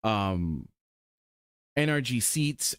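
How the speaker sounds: ordinary talk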